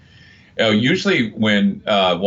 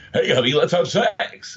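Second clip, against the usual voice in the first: higher, announcer-style come-on; mock the DJ voice at home; lightly voiced cheesy jock; pukey radio voice; 7 semitones higher than usual. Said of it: "do you go home to your girlfriend and do you go".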